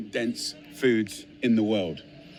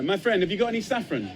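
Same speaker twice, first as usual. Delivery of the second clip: mock-polite ask, pitched up on the question; playful shopkeeper question; 7 semitones higher, faster than usual. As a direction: sweetly ask a shopkeeper for saffron. Asking a shopkeeper for saffron.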